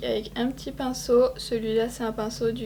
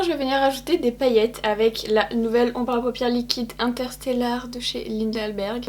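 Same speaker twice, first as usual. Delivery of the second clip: ordinary talk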